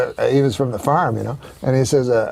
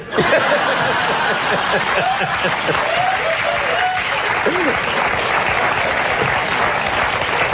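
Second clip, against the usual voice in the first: breathy high laughter; long laugh; 9 semitones higher than usual. Real, not acted. Host cracking up at the Rosie O'Donnell line, long laugh.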